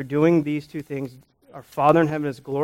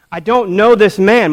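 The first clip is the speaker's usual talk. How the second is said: higher, loud acted protest; emphatic denial; 6 semitones higher, 9 dB louder than usual. Peter answering his accuser, loud emphatic denial. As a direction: deny it forcefully.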